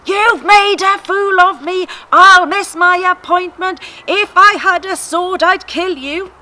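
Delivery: loud, high, furious rant. Acted. Balaam rants at his donkey, loud and high. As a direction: rage at the stubborn donkey. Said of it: "He just carried on ranting".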